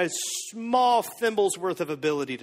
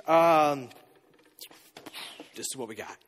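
sheepish apology; 8 semitones lower, 4 dB quieter than usual. Acted. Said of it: "they go".

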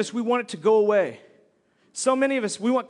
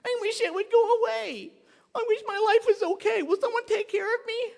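whiny helplessness; 11 semitones higher than usual. Acted. Congregant wishing trouble away, whiny and helpless. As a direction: put on a helpless, high little voice. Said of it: "many times we go".

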